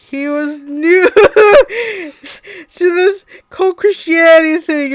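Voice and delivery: high, loud, slow words through real laughter; breaking up mid-sentence; laughing. Real, not acted. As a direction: try to narrate while helplessly laughing. Narrator cracks up while reading.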